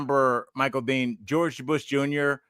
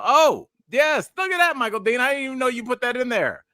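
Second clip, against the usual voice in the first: surprised; 11 semitones higher than usual. Real, not acted. Host spots name on screen.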